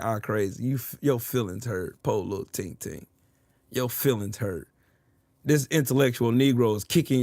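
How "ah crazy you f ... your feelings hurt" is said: mock pity. Real, not acted. Taunting an upset opponent about his hurt feelings, soft mock pity.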